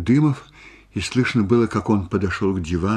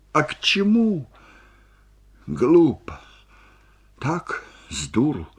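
high-pitched, exasperated outburst; agitated; 8 semitones higher than usual. Acted. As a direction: lament his folly in a raised pitch. Korostelev upset at friend's folly. (взволнованно, с досадой, высоким голосом)

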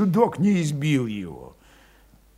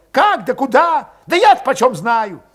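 high, loud exasperated retort; irritated snapping; 13 semitones higher, 8 dB louder than usual. A narrator's reading. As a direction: throw the question back angrily. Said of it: "окрысился вдруг Валет".

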